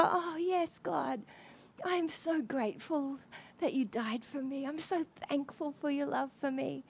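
the man thanking God quietly for his love; grateful prayer. Retold or imitated.

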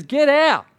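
loud, high rebuke; Jesus driving out a demon; rebuking the demon. Retold or imitated. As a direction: cast it out with a sharp shout. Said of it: "He says".

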